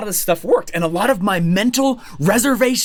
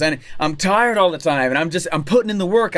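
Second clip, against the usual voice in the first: usual speaking voice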